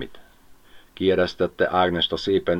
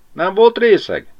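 much higher, louder worried wife; anxious Mari; 13 semitones higher, 8 dB louder than usual. Acted. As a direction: ask in a breathless worry.

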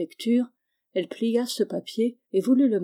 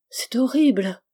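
loud, breathy horrified whisper; 4 semitones higher, 5 dB louder than usual. A narrator's reading. Charlotte recoils from placing her husband, horrified whisper. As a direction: gasp it out in horror. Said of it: "oublié de modérer sa voix".

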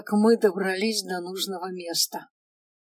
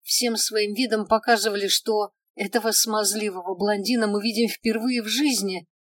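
ordinary delivery, nothing marked

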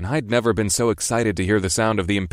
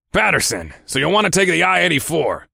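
lightly voiced gruff boss, rising higher; 8 semitones higher, 3 dB louder than usual. Boss tells his driver the route, mildly gruff. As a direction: give directions like a gruff boss.

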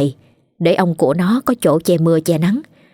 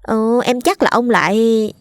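put-on higher, gossiping girl's voice; gossipy servant girl; 4 semitones higher than usual. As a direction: lean in and spill the gossip in a girl's voice.

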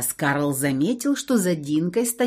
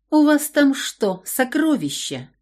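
haughty; 6 semitones higher than usual. Acted. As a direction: look down on him as you ask. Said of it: "высокомерно спросила она".

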